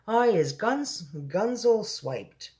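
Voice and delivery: high, drawn-out mock shout; giant's shout. A narrator's reading. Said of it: "shouted the meat".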